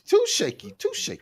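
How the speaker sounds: squeaky falsetto. Pretend. Teasing high squeak amid laughter.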